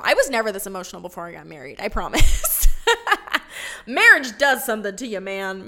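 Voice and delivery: fast, loud giggling protest; laughing it off. Real, not acted. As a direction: laugh at herself while insisting. Laughing at her own emotion.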